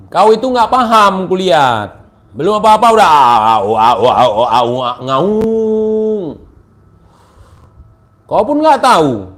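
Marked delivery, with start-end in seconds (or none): 3.6-6.2 mocking dog-like howling. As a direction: howl at him like a yapping dog to mock him. Mocks opponent as howling. (lolongan mengejek)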